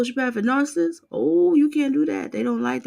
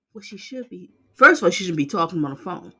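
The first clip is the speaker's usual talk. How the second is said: ordinary talk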